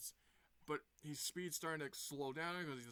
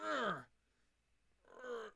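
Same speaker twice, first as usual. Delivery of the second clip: straining grunts; 7 semitones higher than usual. Retold or imitated. Knuckles holding the door.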